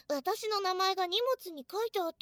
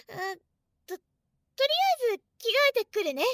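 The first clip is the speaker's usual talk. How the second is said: fast, flustered and higher; flustered rush; 5 semitones higher, 7 dB louder, much faster than usual. Real, not acted. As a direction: hurry it out, embarrassed. Flustered, wants to change.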